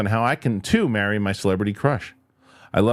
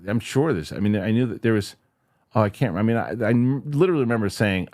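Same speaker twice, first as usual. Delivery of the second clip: usual speaking voice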